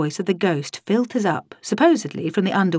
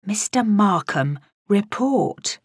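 usual speaking voice

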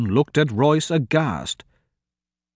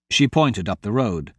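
ordinary delivery

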